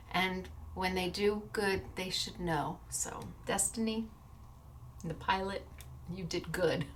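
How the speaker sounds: choked-up thanks. Real, not acted. Vlogger thanking the airline employee, softly near tears. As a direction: thank her quietly with a lump in the throat. Tearful thanks to flight attendant.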